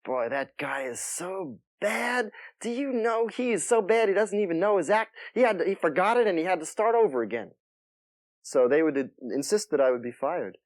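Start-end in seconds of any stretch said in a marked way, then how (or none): 0.0-7.5 scornful nightclub owner. Retold or imitated. Nightclub owners complaining about the comic, mock scornful. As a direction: sneer it like a fed-up boss. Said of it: "they would say things like".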